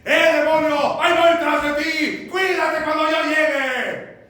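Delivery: high, full-volume blustering threat; Jesús, mock shouting. Preacher parodying Jesus threatening the demon, shouting. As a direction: yell a threat across the distance. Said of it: "No lo hizo así".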